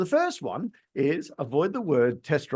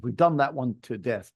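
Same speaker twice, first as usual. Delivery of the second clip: ordinary talk, nothing marked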